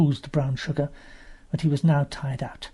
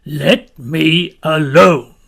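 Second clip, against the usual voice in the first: slow, loud husky creature voice; dry husky voice; 11 dB louder, much slower than usual. Acted. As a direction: growl it out, slow and grumpy. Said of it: "dry, husky voice in the sand".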